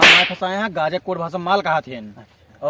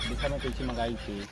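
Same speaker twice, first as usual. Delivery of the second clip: low chuckle; 8 semitones lower, 13 dB quieter than usual. Acted. Laughing low boast.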